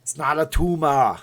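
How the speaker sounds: Austrian action-star accent, TUMOR as "too-mah"; Arnold. Shown as a demonstration. Streamer showing viewers the phrase, Arnold accent. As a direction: say it as a mild strongman impression. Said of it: "In an Arnold voice".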